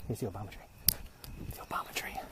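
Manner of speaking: hushed whisper. Real, not acted. Sneaking near Obama's property, whispering. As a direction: whisper it so nobody hears.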